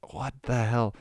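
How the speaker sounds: usual speaking voice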